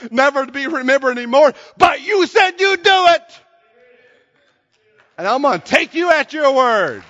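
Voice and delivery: loud, high, rising sermon climax; full-force preaching. Acted. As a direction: preach it out to the whole church, full voice. Preaching, voicing a sinner's prayer.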